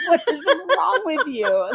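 high, drawn-out incredulous question, half laughing; retold exasperated mom, amused; mock exasperation. Mom retells answering her son's grudge over kissing, laughingly exasperated.

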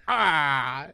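loud mock "ah" cry; imitated Waluigi cry. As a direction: cry out like a cartoon game character. Game character cries out on reveal.

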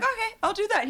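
high, laughing mock guard voice; laughing mimic of an obliging guard; imitating guard. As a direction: squeak out a meek yes through laughter. Guard complying.

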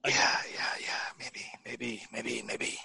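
hushed whispered aside; whispering. Real, not acted. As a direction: whisper it under your breath. Muttered side reply during host talk.

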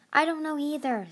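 usual speaking voice

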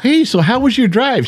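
high, sing-song grandpa greeting; cheery greeting to a child. Retold or imitated. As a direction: greet the little one brightly. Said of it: "walks in, you go".

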